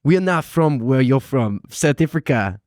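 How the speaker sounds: lightly put-on South African accent. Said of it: "That was a pretty good South Africa".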